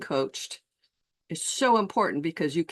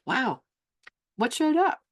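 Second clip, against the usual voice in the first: high, lifted curious question; curious wonder; 8 semitones higher than usual. Retold or imitated. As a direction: sound genuinely fascinated. Coach's curious question to a client.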